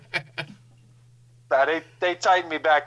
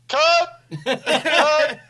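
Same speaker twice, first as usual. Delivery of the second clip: much higher, louder, laughing shout of "Cut!"; director yelling cut; 4 dB louder than usual. Retold or imitated. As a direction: bark it twice like a director stopping the take. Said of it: "I hear Kev go".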